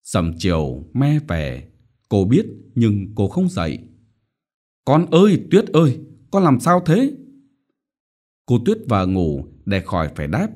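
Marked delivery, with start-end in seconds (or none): 4.8-7.1 higher, louder anxious call; worried mother. A narrator's reading. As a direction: call out to the daughter. Mother calls her daughter. (mẹ lo lắng gọi con)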